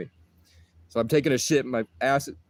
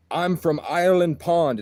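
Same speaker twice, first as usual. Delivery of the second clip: slow, higher-pitched mock Scottish accent; imitated Scottish accent; 6 semitones higher, 4 dB louder than usual. Giant stranger saying where he lives, imitated Scottish accent. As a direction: stretch "Ireland Pond" in a put-on Scottish accent. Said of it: "but with his accent, it's Ireland".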